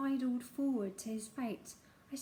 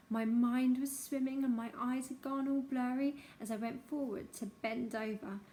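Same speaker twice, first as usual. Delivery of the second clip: usual speaking voice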